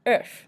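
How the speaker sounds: Œuf with a rounded er vowel, then F; final F sounded. Shown as a demonstration. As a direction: say Œuf as French uhf. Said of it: "we pronounce the F at the end".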